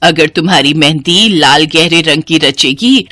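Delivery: mother-in-law advising the bride. Acted. (सास की हल्की भारी स्नेहभरी आवाज़)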